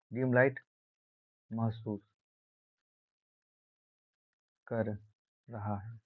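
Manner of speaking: spaced-out words, dropping away in volume; slow dictation. Shown as a demonstration. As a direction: dictate it with long gaps between words. Dictates the line word by word.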